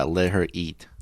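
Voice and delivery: ordinary delivery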